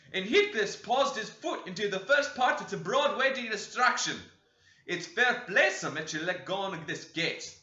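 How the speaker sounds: put-on loud, high outraged old servant; shouting complaint. Joseph telling the master the children's misdeeds, loud and outraged. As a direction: cry out tales on the children. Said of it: "shouted our chaplain".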